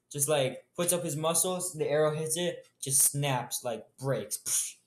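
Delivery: normal talking voice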